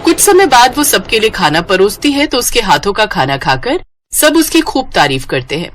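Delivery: ordinary delivery, nothing marked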